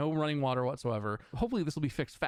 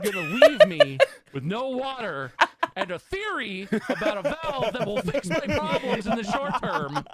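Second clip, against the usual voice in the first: exasperated laugh; 6 semitones higher, 7 dB louder than usual. Real, not acted.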